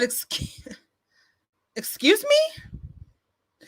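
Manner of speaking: high, drawn-out offended "excuse me"; acted indignant disbelief. Snapping back at a commenter who warned her, indignant. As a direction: play up being affronted, pitch shooting up at the end. Taken aback by a warning.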